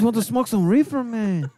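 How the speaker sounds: a hint of Chicano stoner accent; Cheech. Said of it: "Fucking Cheech out there".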